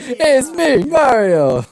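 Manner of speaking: loud, high, hammed-up Italian uncle; Mario. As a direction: belt out a mock Italian greeting. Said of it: "like, uncle Mario. And he's like".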